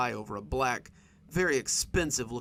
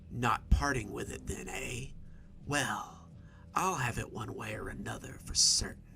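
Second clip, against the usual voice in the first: raspy, wheezing, half-whispered; raspy half-whisper, half-wheeze. A narrator's reading. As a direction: hiss it like a lifelong chain smoker. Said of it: "a raspy voice like that of a lifelong chain smoker, half a whisper, half a wheeze".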